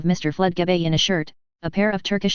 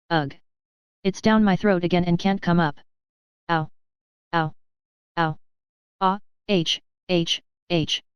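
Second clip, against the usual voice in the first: choking cries and crowing. A narrator's reading. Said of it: "crowing fearfully".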